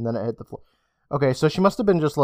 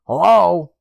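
louder, higher call; 5 semitones higher, 8 dB louder than usual. Acted. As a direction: call out nervously. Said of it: "she called again".

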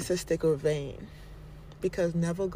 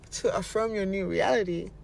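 ordinary delivery

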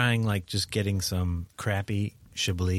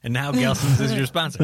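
mock radio announcer; 3 semitones higher, 3 dB louder than usual. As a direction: announce the sponsor grandly.

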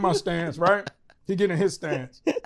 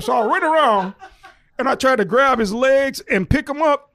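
normal talking voice

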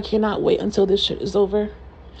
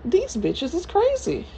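much higher, slow, drawn-out disbelief; mock-scandalized drawl, pitched way up; D'Andra impression; 10 semitones higher, slower than usual. Podcaster mocks D'Andra judging the cast, high slow drawl. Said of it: "This is my impression of D'Andra".

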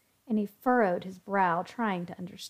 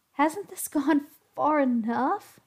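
slow, higher pleading; 6 semitones higher, 4 dB louder, slower than usual. A narrator's reading. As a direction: beg them to stop. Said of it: "pleaded Zach".

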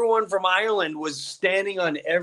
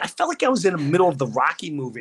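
ordinary talk, nothing marked

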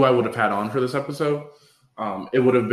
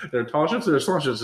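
rushed, higher-pitched tongue twister with a laugh; five times fast; 5 semitones higher, faster than usual. Shown as a demonstration. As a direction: rattle it off playfully at double speed. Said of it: "Say it five times fast".

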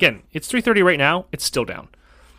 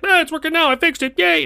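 mock triumphant tech; 14 semitones higher than usual. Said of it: "he's like".

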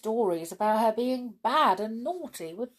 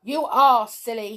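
louder, higher storybook scolding; very angry; 5 semitones higher, 9 dB louder than usual. A narrator's reading. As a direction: scold angrily as a cross toy. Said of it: "and very angry".

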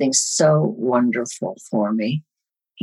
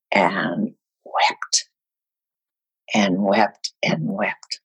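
low, then fading to an unvoiced whisper; 8 semitones lower, much slower than usual. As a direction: let each wept fade further away. Fading retelling of weeping.

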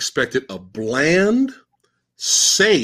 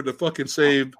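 normal talking voice

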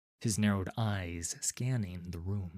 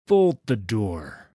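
loud, slow command; firm order; 11 dB louder, slower than usual. Acted. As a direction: order them to lock up. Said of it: "he ordered".

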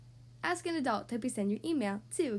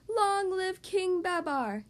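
slow, drawn-out louder cheer; shouted in unison; 6 semitones higher, 4 dB louder, much slower than usual. Acted. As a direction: hail the new king together. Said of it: "the elephants shouted with one voice".

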